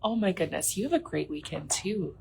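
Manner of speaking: usual speaking voice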